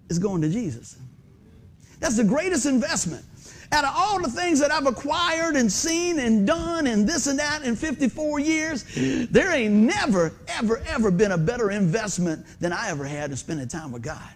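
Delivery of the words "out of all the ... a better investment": slow, high-pitched preaching emphasis; emphatic testimony. Real, not acted. Preacher testifying about fifty-four years of life, slow emphatic build. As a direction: draw each phrase out, pitched up, landing hard on "never".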